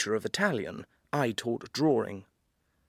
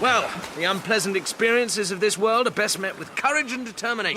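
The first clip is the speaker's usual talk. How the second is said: hearty bravado; 8 semitones higher, 4 dB louder than usual. Acted. Bracing himself before cold dip.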